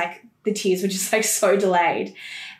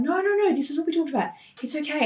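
reassuring; 8 semitones higher, faster than usual. Retold or imitated. Midwives reassuring crying mother.

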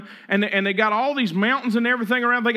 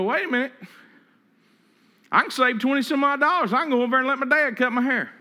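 mock inner voice; 6 semitones higher, faster than usual. Preacher voicing his own thoughts.

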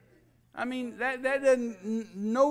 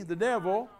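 ordinary talk, nothing marked

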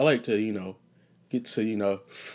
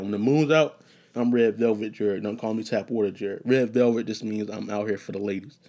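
ordinary talk, nothing marked

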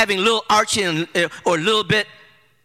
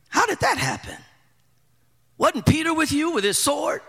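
much higher, softer questioning voice; curious questioner; 6 semitones higher, 4 dB quieter than usual. Retold or imitated. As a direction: lift it up high like an eager friend asking. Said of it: "the conversation probably would have gone something like this".

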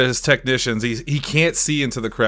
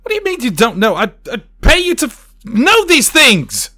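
shouting, much higher and louder, slowing down at the end; furious magician; 13 semitones higher, 8 dB louder than usual. Acted. Magician yells at his assistant as people leave, furious shout. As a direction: blow up at her as the crowd leaves.

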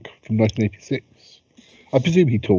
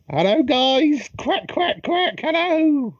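high squeaky cartoon duck; chirpy little duck voice; slower than usual. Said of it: "Ozymandias the talking duck".

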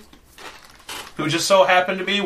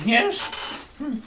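put-on very old woman; 10 semitones higher, 4 dB quieter than usual. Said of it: "this very old lady".

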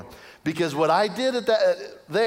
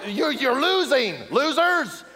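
taunting; 10 semitones higher than usual. Retold or imitated. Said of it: "they're like".

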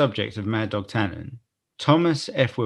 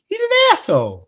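high-pitched, louder mock exclamation; Marty; 5 dB louder than usual. Co-host quoting Marty to the bar, high mock outburst. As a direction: squeal it out as a comic film quote. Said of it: "Marty just looks at everyone".

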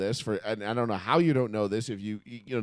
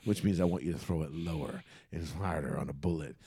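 imitating Andy Reid; 9 semitones lower, 9 dB quieter than usual. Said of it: "Andy Reid's like".

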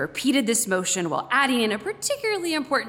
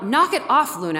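higher and louder, scolding the dog; 4 semitones higher, 6 dB louder than usual. Retold or imitated. Owner scolding Luna to stop teasing cats, loud. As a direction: call it out sharp to stop the game. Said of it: "I heard a loud".

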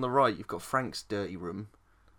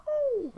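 very high little squeal of "oh"; high-pitched "oh"; 4 dB louder than usual. Retold or imitated. Acting out Kirsty seeing the hobo, high squeal. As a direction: imitate a startled, squeaky "oh". Imitating Kirsty's reaction in film.

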